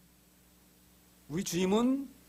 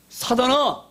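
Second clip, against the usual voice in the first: raised, higher-pitched cry of rebuke; sharp rebuke; 5 semitones higher, 4 dB louder, much slower than usual. Acted. Jesus rebuking Peter, sharp and loud. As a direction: call out a stern reprimand.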